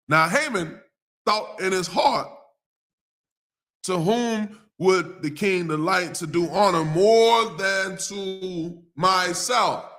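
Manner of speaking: slow, drawn-out Bible reading; lingering pulpit read-aloud; scripture reading. Acted. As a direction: read the verse deliberately, stretching the final words.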